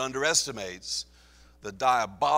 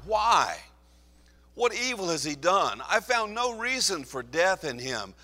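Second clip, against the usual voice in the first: higher, louder, pleading; desperate Pilate; 6 semitones higher, 5 dB louder than usual. Acted. Pilate desperate before the crowd, raised higher. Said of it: "He's getting desperate now".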